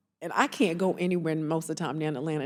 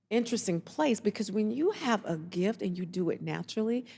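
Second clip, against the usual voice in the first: usual speaking voice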